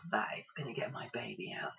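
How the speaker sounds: breathless whispered awe; awed whisper. Retold or imitated. To Rose across the room, whispered in awe. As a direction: whisper it as a sudden realisation. Awed remark during labour.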